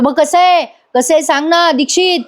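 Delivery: mock yell, pitched high; retold yell. Mahipat yelling at Dixit for an answer, mock yell. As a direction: act out an indignant high-pitched holler. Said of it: "Mahipat ओरडतो आणि म्हणतो".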